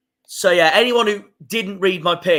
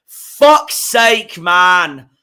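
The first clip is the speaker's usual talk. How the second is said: frustrated curse; 3 semitones higher, 7 dB louder, much slower than usual. Real, not acted. Fan cursing the fixture schedulers, loud frustration. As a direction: blurt it in disgust.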